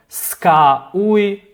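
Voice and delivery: "ska uj" drawn out very slowly; slow spoken contraction. Shown as a demonstration. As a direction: stretch "ska uj" so the contraction is heard. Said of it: "S apostrophe".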